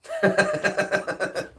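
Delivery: high, staccato chuckle; real laugh. Real, not acted. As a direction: laugh in a quick high run. Cracks up at the meme.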